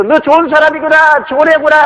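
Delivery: very high sing-out call; echoed praise. Acted. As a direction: throw the praise back like an echo. Echo repeats nice words.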